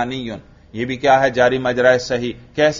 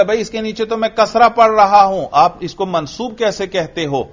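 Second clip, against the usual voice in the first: higher-pitched challenge, rushed at first; imagined objector; 7 semitones higher than usual. Acted. Teacher voicing someone who disputes the grammar, objecting. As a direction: put on a questioner raising a quick objection. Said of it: "وہ پھر اعتراض کرے گا".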